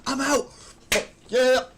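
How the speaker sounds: high, loud triumphant shouting; whooping with relief; excited relief. Real, not acted. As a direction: celebrate escaping at last. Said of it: "I'm out of the accent".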